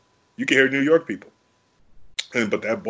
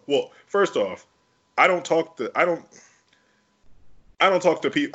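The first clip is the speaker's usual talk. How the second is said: usual speaking voice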